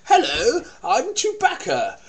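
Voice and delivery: high, slow posh voice for Chewbacca. Retold or imitated. Imitating young Chewbacca to co-host, high posh voice. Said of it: "He said".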